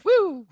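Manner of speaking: high, loud playful whoop. Pretend. Player slides down the hatch, playful high whoop. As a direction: whoop as if sliding down.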